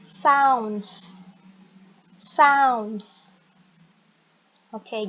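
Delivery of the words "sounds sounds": "sounds" said slowly and distinctly; pronunciation demo. Shown as a demonstration. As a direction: model "sounds" for learners, drawn out. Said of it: "Ini bacanya adalah". (contoh pelafalan "sounds" yang jelas)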